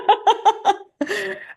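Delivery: a high, delighted laugh; high bright laugh. Real, not acted. Laughs after retainer disclosure.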